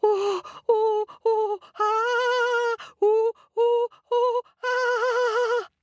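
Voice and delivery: high, breathless oh's; frightened panting. Acted. Monkey up the tree, panting in high fright. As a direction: gasp in fright after escaping.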